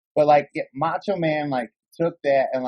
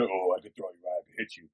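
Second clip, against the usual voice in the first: imitating big dudes; 6 semitones lower, 8 dB quieter than usual. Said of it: "all them big dudes are".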